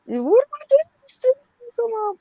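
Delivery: high, loud, frantic wail close to crying; panicked shriek. Retold or imitated. Carol panics about the loose wheel.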